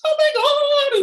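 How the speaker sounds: very high, drawn-out imitated shriek; amazed squeal. Store lady hearing Olympic news, squealing. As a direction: stretch it out in a squeaky wail of amazement.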